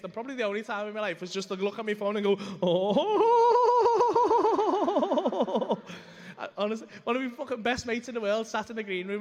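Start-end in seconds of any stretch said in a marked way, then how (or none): 2.6-6.3 long high wavering whoop; loud "oh" climbing into a falsetto trill; drawn-out "ooh". Retold or imitated. Comedian re-enacts seeing the offer on his phone, high drawn-out whoop. Acts reaction to foursome offer.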